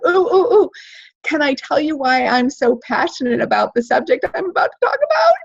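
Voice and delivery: high, eager, laughing plea to share; eager pleading. Shown as a demonstration. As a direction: bounce with eagerness to be called on. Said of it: "like you really wanted to be called on".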